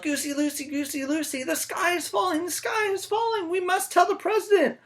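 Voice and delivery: very high, loud frantic cry; very high frantic yell; yelled panic. Acted. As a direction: squawk the alarm at the goose. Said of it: "and yelled".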